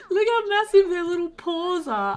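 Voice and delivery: high, loud squeal of adoration; gushing over cuteness. Real, not acted. Player seeing the puppies' little paws, gushing. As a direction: gush as if overcome by tiny puppies.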